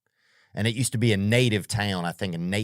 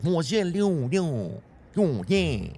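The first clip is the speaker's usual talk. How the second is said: mock nasal singer; 8 semitones higher, slower than usual. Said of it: "It sounds like Japanese".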